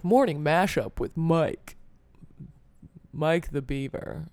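slow, drawn-out mock morning-show intro; cheesy radio DJ. Mocking morning radio hosts, a slow, cheesy DJ intro. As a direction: ham up a corny morning DJ, slowly. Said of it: "They're like".

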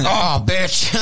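Scary Terry. Retold or imitated. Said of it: "I felt like Scary Terry".

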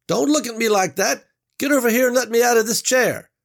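high, loud bellowing boss; furious tied-up boss. Acted. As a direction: yell it from the chair, outraged. Tied-up boss yells again.

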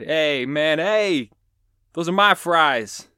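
high, loud bad gangster voice; hammed-up thirties Italian gangster shout; terrible Italian gangster seagull. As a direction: squawk it like an indignant mobster gull. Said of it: "they sound like the nineteen thirties Italian gangsters".

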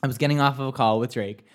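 ordinary talk

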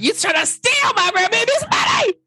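very high, indignant mock screech; Mama Joyce. Grandmother accusing a gold digger, high hammed-up outrage.

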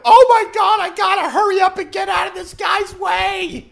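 high, frantic mock panic shouted; panicked New Yorker. Mocking the flustered driver, a high panicked yelp. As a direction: squeal like a flustered driver rushing to move. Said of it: "as soon as a New Yorker hears a beep".

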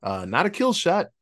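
ordinary delivery

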